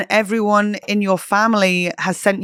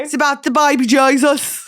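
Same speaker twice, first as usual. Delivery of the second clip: a hammed-up Birmingham accent, pitched high; Brummy accent; 8 semitones higher, 3 dB louder than usual. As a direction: try the Brummy accent once more. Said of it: "Was that close?".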